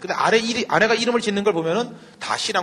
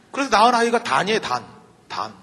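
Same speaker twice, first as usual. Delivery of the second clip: ordinary delivery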